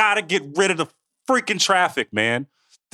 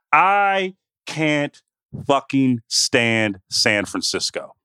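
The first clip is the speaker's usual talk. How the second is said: slow, heavy stress on fucking; drawn-out exasperated complaint; emphatic rant; 2 semitones lower, much slower than usual. Real, not acted. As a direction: lean hard into every word of the gripe. Ranting about hating the city.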